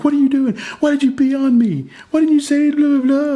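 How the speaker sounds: high, loud mocking voice of an exasperated wife; husband mimics his wife scolding the baby, high and loud. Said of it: "She's exasperated".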